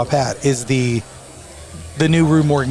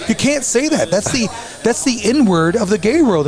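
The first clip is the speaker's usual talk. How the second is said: much higher, scandalised protest; shocked objection; 8 semitones higher than usual. Retold or imitated. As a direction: object in disbelief to grandma. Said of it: "And I'm like".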